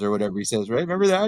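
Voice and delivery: ordinary talk, nothing marked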